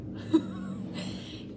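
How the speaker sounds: choked laugh into tears; tearful laugh. Real, not acted. Remembering her partner wanting to stay, a tearful laugh. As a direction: break down with a small laugh. Emotional after long pause.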